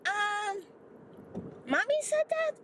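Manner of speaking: put-on childlike surprise; puzzled granddaughter. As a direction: innocent child asking.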